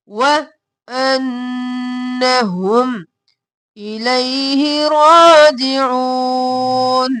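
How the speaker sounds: very slow, each syllable held long; slow tajweed recitation. Shown as a demonstration. Teacher modelling الذین یظنون for students, slowly chanted.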